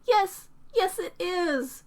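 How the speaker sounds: a touch of a hiss; hissed insistence. A narrator's reading. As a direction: insist through embarrassment. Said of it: "she hisses".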